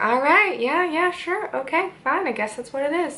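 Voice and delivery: lightly voiced Mulder caving in; exasperated mock Mulder. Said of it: "Mulder's like".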